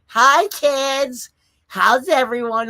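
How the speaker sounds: put-on a grandma leaning into the webcam to greet the kids; mock grandma on Zoom. Grandma greeting the kids on a Zoom call, mock cheery. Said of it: "your grandma's doing Zoom".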